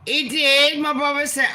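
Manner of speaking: jumps up high and loud with excitement; excited. Real, not acted. Excited, telling viewers about her brother. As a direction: burst out over the news.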